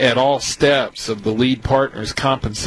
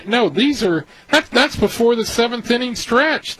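agitated excitement; 9 semitones higher than usual. Real, not acted. Advisor objecting to syndicators raising their promote, agitated. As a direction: pitch jumps up, worked up. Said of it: "agitated might be a better word".

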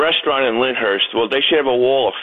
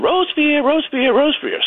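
mother duck calling; 12 semitones higher than usual. Retold or imitated. Retelling a cartoon, mother duck calls her duckling, high calling voice. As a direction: call out the name like a worried mother duck.

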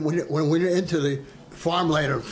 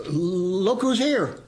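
stuttered line; 4 semitones higher, much slower than usual. Retold or imitated. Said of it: "I stuttered a little bit".

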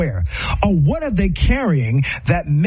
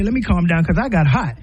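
ordinary delivery

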